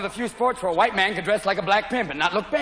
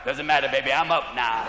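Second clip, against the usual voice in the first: much lower, laid-back player voice; cool coked-up player; 8 semitones lower than usual. Retold or imitated. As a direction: lazily brush off the umpire, voice dropped low. Coked player answers umpire.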